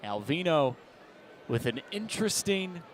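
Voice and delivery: usual speaking voice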